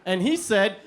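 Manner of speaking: usual speaking voice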